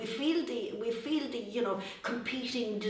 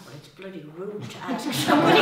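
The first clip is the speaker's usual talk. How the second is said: laughing indignation; 3 semitones lower, 10 dB louder than usual. Retold or imitated. Indignant at being questioned.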